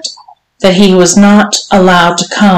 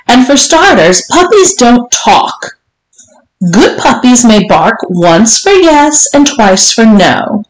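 higher, measured madam laying down rules; domineering mistress; 5 semitones higher than usual. Acted. Mistress to her servant, slow and imperious. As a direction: spell out the rules with relish. Voices the story's Missus Johnson.

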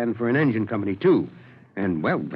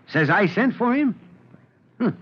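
incredulous question, pitched up high; puzzled surprise; 8 semitones higher than usual. Acted. Chief questions clerk about unexpected visitor, puzzled. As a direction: echo it back in disbelief.

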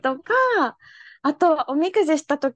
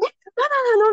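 surprised; 9 semitones higher, much faster than usual. Retold or imitated. Tourists eager to cross again.

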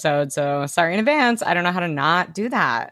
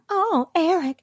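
high, dreamy mermaid sigh; cartoon princess; 11 semitones higher than usual. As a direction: swoon over Eric. Voicing Ariel.

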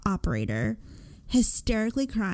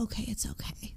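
hushed whisper to herself, steadying; whispered self-calming; 11 dB quieter, faster than usual. Retold or imitated. Scared wife whispering to herself by the door. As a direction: whisper it to calm your own nerves.

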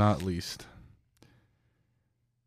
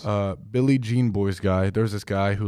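usual speaking voice

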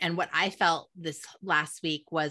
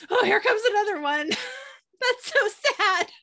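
high, louder, tearful wail with laughter; hammed-up crying, pitched way up; mock breakdown sob; 13 semitones higher, 4 dB louder than usual. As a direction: act out a fresh wave of sobs. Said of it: "breakdown sobs".

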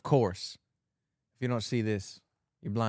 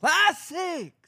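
excited. Real, not acted. Cheering the game list.

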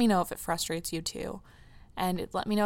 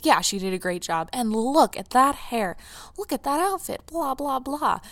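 airy, higher mock sports-commentator gush; 6 semitones higher, 3 dB louder than usual. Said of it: "what we're talking about is".

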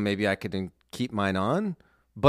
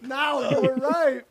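laughing; 3 dB louder, slower than usual. Real, not acted. Laughing after guessing.

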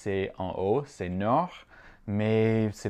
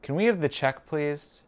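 higher-pitched, flowing question; whole question run together, raised pitch; connected phrase; 6 semitones higher than usual. Shown as a demonstration. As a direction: ask it in one go, pitched up. Teacher gives whole phrase first.

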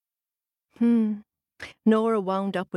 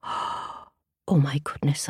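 surprised gasp; 8 semitones lower, much slower than usual. Retold or imitated. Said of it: "I heard you say".

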